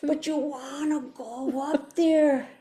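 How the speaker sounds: high, drawn-out pleading; long wailed oh, then slow, high coaxing; yearning inner voice. Acted. Part of her begging to go up, yearning. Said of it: "part of me is going".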